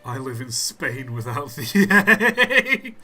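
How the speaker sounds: cracking up mid-sentence; laughing. Real, not acted. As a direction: laughs through the punchline. Laughing reading a pun.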